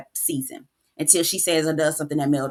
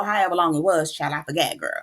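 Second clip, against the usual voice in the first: ordinary talk, nothing marked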